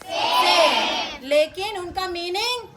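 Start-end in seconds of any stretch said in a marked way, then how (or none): 0.0-1.3 सेम drawn out very slowly; stretched answer. Shown as a demonstration. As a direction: hold सेम long for the class. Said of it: "सेम है कि अलग है?".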